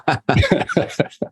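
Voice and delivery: quiet airy laugh; soft laugh under breath; breathy laugh. Real, not acted. Shared laugh after joke.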